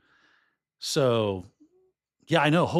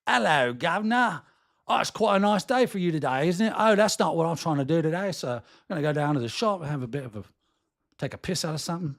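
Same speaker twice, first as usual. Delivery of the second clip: higher-pitched Cockney "governor" accent; mock British accent; 4 semitones higher than usual. Shown as a demonstration. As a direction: chirp along in a hammed-up Cockney accent. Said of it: "if you're a little gun shy, do the".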